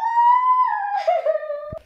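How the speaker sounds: loud, high burst of laughter; high giggle burst. Real, not acted. Girl cracking up after her silly chant, loud high laugh. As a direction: let out a big giddy laugh.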